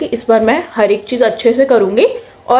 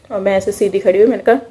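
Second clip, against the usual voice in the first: normal talking voice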